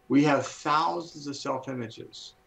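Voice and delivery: ordinary talk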